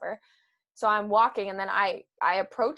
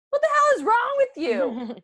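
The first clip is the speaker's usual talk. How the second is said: high-pitched, louder mock outburst; imitating incredulous dad; 14 semitones higher, 7 dB louder than usual. Dad asks his startled daughter what's wrong, mock incredulous outburst. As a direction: act out dad's baffled question, pitched way up. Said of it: "busting out laughing, and he goes".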